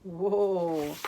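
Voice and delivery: usual speaking voice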